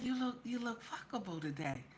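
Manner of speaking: hushed quoted compliment; imitating his soft murmur. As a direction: quote him very softly, almost under your breath. Quotes him in the car.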